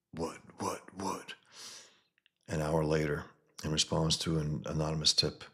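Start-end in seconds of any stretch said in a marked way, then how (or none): 0.1-1.3 unvoiced startled whisper; confused whisper. A thug blinded in the dark, whispering confused. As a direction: hiss it out, lost in the dark.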